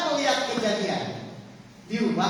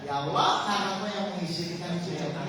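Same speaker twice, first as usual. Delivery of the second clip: quiet inner prayer; 6 semitones lower, 4 dB quieter than usual. Shown as a demonstration. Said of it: "geser pikirannya".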